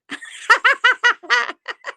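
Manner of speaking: loud high burst of laughter. Real, not acted. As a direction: break into a bright laugh. Laughs before taking over.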